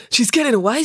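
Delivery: high, loud, urgent warning; alarmed call. Acted. Joe calls out during escape.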